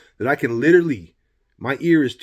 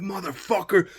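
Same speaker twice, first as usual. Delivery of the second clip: high-pitched retold curse; mock furious cry; re-enacted rage; 7 semitones higher than usual. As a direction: replay the rage, held back in volume. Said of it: "I'm fucking just raging".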